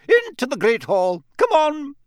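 high, loud, rallying command; stern professor calling to a crowd; sharp order. Acted. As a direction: chivvy the pupils inside. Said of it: "said Professor McGonagall sharply".

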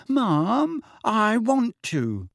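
put-on high, drawn-out childish whine; wailing. A narrator's reading. Daughter begging her mother to stay, wailing. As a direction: wail it slowly to mum. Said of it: "wailed Ginny".

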